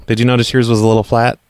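ordinary delivery

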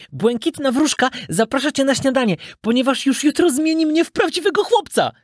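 high, excited puppet voice; puppet calling out. A narrator's reading. Puppet inviting his best friend, calling out. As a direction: call the invitation out high and eagerly. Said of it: "zawołał pajacyk".

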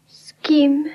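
short whispered question. Acted. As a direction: breathe the question out. Intimate night talk, whispered.

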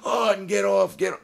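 high, loud retold yell of the guards; retold screaming. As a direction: shout it like guards pulling him off. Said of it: "till they're screaming".

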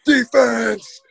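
put-on the old Camp of Champions cry; Defense yell. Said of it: "slap the ground".

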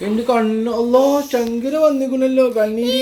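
high, cooing sympathy. Real, not acted. Parent fussing over the baby's tears, pitying coo.

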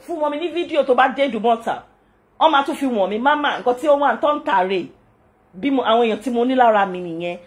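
normal talking voice

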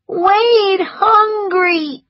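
put-on high, very slow child whine; little boy pleading; child's whine. A narrator's reading. Wade telling Scarlett he's hungry, slow whine. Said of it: "he cried".

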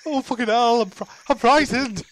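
ordinary delivery

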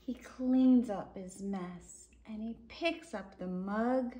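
lively, sing-song picture-book reading; reading to children. A narrator's reading. Reading a dinosaur book to children, lively storybook voice. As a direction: read it out like a bedtime story.

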